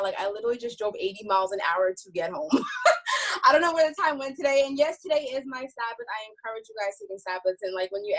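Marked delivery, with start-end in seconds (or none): 2.5-3.4 breathless laugh. Real, not acted. Laughing at her own rush.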